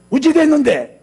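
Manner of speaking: high, loud, gruff demand; mock-angry Gyeongsang-style demand; angry-sounding question. Shown as a demonstration. Preacher showing how not to ask, angry-sounding question. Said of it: "화가 나갖고 말하는 것처럼 들려요".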